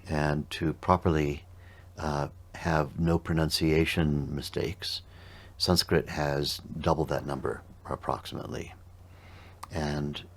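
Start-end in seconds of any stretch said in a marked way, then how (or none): none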